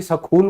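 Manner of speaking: स्कूल as "suh-khool" in two syllables; wrong pronunciation. Shown as a demonstration. Teacher predicting students will say स्कूल as "suh-khool". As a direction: say स्कूल with aspirated KH and added vowel. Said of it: "स्कूल नहीं बोलेंगे".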